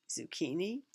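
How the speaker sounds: ordinary delivery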